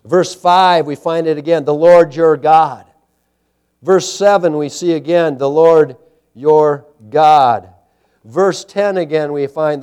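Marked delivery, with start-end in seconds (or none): 1.6-2.9 slow, loud weight on "Lord your God"; emphatic stress. Real, not acted. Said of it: "the emphasis". 5.4-7.7 each word of "Lord your God" set apart, slow and loud; emphatic stress. Real, not acted. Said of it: "the emphasis".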